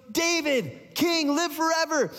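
high, triumphant calling out; breathless-with-news acted hail; excited herald. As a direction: proclaim it to the king in a high voice. Runner bursts in with news.

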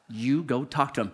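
low, hushed voice of conscience; quiet voice from within; inner voice. Retold or imitated. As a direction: speak it softly and low, as a calm command. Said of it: "there was this voice that said".

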